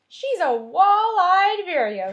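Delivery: high, loud, slow know-it-all pronouncement; scientific. Acted. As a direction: announce it like a young expert. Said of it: "said George scientifically".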